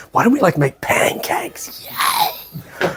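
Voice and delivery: slow whispered stoner, then a whispered yay; slow conspiratorial whisper ending in a whispered cheer; stoned parent whisper. Retold or imitated. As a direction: hush it like a giggly stoned mum sneaking a snack. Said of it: "you're like".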